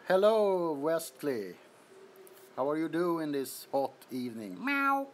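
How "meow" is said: high playful meow; cat meow. Pretend. Playing the cat for the stream viewers, high meow.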